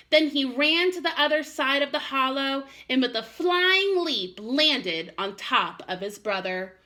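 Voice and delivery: put-on gentle storybook narration for kids; reading to children. A narrator's reading. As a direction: read it like a bedtime story for little ones. Teacher reads a children's story aloud.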